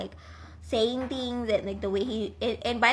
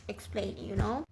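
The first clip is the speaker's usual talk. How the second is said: normal talking voice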